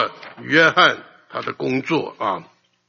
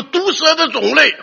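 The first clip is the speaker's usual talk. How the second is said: stern rebuke; 13 semitones higher, 5 dB louder than usual. Retold or imitated. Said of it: "他劈头就告诉我".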